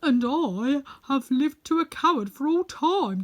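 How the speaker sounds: loud, proud lion telling of his forest. Acted. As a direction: play the Lion, booming out his news.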